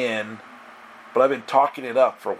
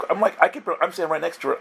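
ordinary talk, nothing marked

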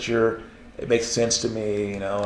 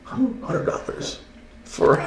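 stunned disbelief; 12 semitones higher, 3 dB quieter, much slower than usual. Retold or imitated. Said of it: "I went".

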